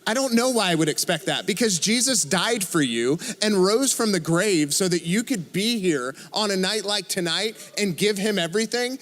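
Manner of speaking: normal talking voice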